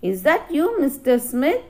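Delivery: ordinary talk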